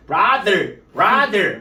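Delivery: brother loud, tongue pushed out on the th, r curled hard; hammed-up English brother; exaggerated th. Shown as a demonstration. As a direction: overdo the th in brother with tongue stuck out. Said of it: "혀도 이렇게 내밀어가지고".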